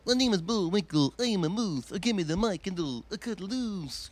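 high, loud cartoon moose voice rapping; Bullwinkle impression. Teen comic raps as Bullwinkle, cartoon voice. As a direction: ham up a goofy cartoon moose. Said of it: "I would do these cartoon impressions".

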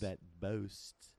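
put-on British echo; British accent drill. Guest repeating after the host, British elocution. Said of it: "Okay, try this".

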